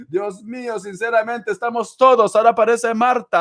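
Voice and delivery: high, loud and fast announcement; excited. Real, not acted. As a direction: rush to greet the newcomer. Excited as a new viewer arrives.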